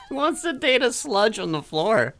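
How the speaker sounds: high-pitched jeer through laughter; laughing mockery. Co-host joking about the friend's crush, laughing mockery. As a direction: crack up while delivering the mock insult.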